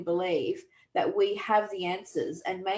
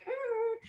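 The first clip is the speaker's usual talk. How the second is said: high whining yelp like a hurt dog; imitated dog whine; 5 dB quieter than usual. Man telling a neighbor's dog story, mimicking the dog's high whine. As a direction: let out a short high canine whimper. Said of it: "whimpering and whining and going".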